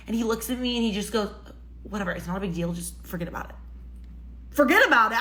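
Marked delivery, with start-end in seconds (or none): 1.8-3.5 low, fading to a whispered forget about it; dismissive brush-off. Retold or imitated. Date brushing her off, low and softly dismissive. 4.5-5.2 higher and louder, Forget about it? in disbelief; incredulous echo. Real, not acted. Repeating the date's brush-off, louder in disbelief.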